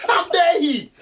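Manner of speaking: squeezing out the words through laughter; mock indignation between laughs; hammed-up outrage while laughing. Real, not acted.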